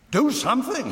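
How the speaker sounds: high, urgent order; exasperated command. A narrator's reading. Householder orders boys amid smoke.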